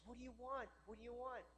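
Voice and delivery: hushed, lightly cooing question; fussing dog owner. Acted. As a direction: softly imitate an indulgent pet owner. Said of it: "It's the little yappy dogs".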